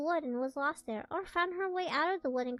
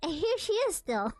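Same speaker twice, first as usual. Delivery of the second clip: louder, excited little bear cry; lightly voiced little bear, raised and eager; little wee bear voice; 4 semitones higher, 5 dB louder than usual. A narrator's reading. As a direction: squeal it out like a small cub who found her. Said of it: "little wee bear's little wee, wee voice".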